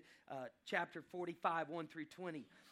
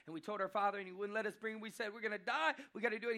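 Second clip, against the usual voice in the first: urgent pleading; 5 semitones higher, 5 dB louder, faster than usual. Acted. Judah recounts pleading with father.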